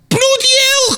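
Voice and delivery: high-pitched shocked yelp; shocked outburst. Acted. Caller hearing what a cymbal costs, shocked outburst. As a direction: exclaim in disbelief, shooting right up in pitch.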